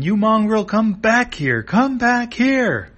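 high, loud chasing yell; furious cartoon shout; angry yelling. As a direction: yell after a runaway, stretching the last "here". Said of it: "Kira yelling".

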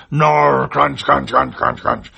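loud growling chomp sounds; crunching noises. Acted. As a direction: gnaw and crunch like a beast. Beast eating the wallet.

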